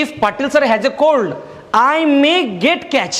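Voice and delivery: very slow, word by word; slow dictation. Shown as a demonstration. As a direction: dictate it slowly to the class. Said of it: "मग तेव्हा म्हणू शकतो मी".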